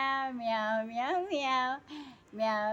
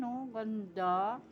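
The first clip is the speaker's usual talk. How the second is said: ordinary talk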